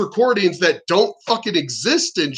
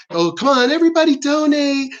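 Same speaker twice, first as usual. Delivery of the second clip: higher, hammed-up celebrity begging for donations; mock Hollywood telethon plea; 8 semitones higher than usual. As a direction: parody a rich star pleading on a telethon. Mocking Hollywood telethon hosts.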